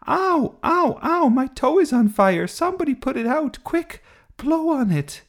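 high, loud yelps of pain; pained panic. A narrator's reading. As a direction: cry out for help as if your toe is burning. Toe burning in pretend lava.

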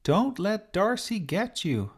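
high pleading call to the bird; shouted warning. Acted. Sophie calling to the sparrow about the cat, high urgent shout. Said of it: "she shouted".

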